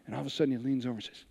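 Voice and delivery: ordinary delivery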